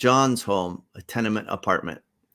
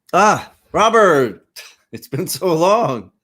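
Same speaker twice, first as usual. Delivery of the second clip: drawn-out, high, loud welcome; effusive greeting; 9 semitones higher, 8 dB louder, slower than usual. Acted. John welcoming Robert into his home, effusive. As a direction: stretch the name in a big hearty hello.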